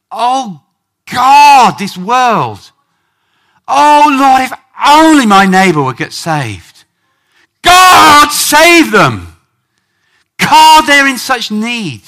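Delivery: loud, high cry to God in mock labour; anguished birth-pang prayer. Preacher crying to God for the unsaved, anguished and loud. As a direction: cry out as if pleading through birth pangs. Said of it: "start to feel some birth pangs".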